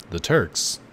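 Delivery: ordinary talk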